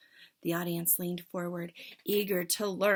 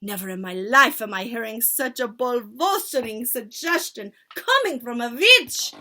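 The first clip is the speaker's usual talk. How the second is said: screeching insult; 4 semitones higher, 11 dB louder than usual. Acted. Witch leader screeching at the culprit, loud. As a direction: spit the insult. Furious tirade.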